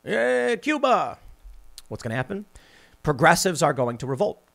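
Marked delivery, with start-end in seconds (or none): 0.0-1.2 higher-pitched mocking Biden impression; hammed-up Biden sneer; mock Joe Biden. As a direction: put on a doddering old-man Biden voice. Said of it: "if Joe Biden comes out and says".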